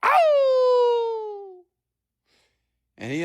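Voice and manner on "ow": long, high imitated dog howl; high-pitched hound's howl, drawn out. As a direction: howl like a dog, sliding slowly down. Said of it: "his dog was just howling".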